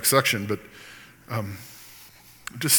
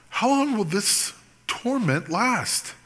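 lament cry; 8 semitones higher, 4 dB quieter, slower than usual. Shown as a demonstration. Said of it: "So cry out to him".

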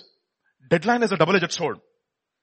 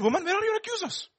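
gentle question; 4 dB quieter than usual. Acted. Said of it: "Jesus said".

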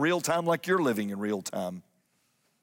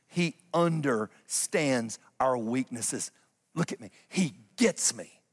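normal talking voice

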